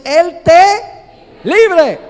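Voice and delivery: clamor alto, fuerte y muy lento; súplica desesperada. Acted. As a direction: implora como un rey impotente. Rey suplica a Daniel ante foso. (rey clama a Daniel junto al foso, suplicante)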